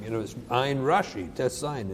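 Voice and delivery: ordinary delivery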